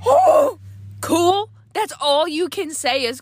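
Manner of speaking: high, loud outraged "cool?"; mock outrage at husband's flat reaction; indignant disbelief. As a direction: throw his word back at him incredulous.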